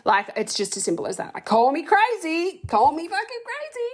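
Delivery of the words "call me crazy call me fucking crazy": defiant. Real, not acted. Defiant outburst, pitch jumps high.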